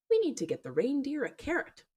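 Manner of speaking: little son's voice. Retold or imitated. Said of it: "he's just like".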